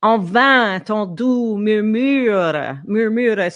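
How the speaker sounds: En vain, ton doux murmure sung out slowly, each French vowel savored; savoring French vowels. Shown as a demonstration. Coach demonstrating to singer, slow savored French vowels. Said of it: "So it's those French vowels, just enjoy them".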